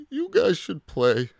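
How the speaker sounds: high, loud character voice; high-pitched storybook resignation; resigned dinosaur. A narrator's reading. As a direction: give in with a high voice. Rejected Minosaur gives toys away.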